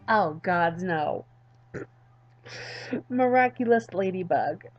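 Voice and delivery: usual speaking voice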